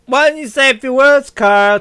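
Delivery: high, loud mocking request; taunting. Guests taunting Carl to speak, mocking.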